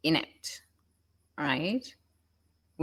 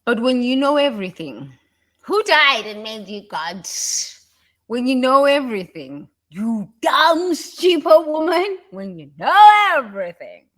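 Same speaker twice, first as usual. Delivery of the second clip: higher and louder, scolding; mock angry rant; 6 semitones higher, 8 dB louder than usual. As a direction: tear into the know-it-all. Ranting at a know-it-all.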